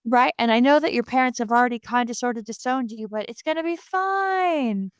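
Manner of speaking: high, mock-reassuring boyfriend, stretching out the ending; glib reassurance. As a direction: brush off the worry in a singsong high pitch.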